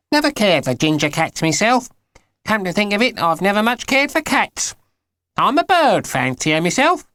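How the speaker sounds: high, chatty put-on old neighbour; old geezer voice. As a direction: ramble on like the old man next door. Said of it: "said the old geezer next door".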